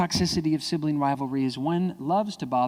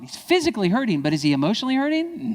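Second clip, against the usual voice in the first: ordinary delivery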